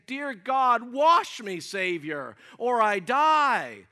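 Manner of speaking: loud, high prayerful plea; crying out. Acted. Sinner prays for washing from the Savior, crying out. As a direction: cry to God, stretching the last words. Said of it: "the writer cries out and says".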